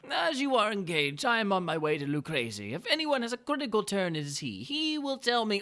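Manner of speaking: butter him up with mock delight; Montresor's sly greeting. Montresor baits him with Lucrezi.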